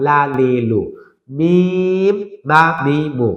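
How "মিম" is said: মিম with a long, held vowel; drawn-out letter name. Shown as a demonstration. Teacher naming a letter for learners, drawn out. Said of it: "টেনে বলতে হবে".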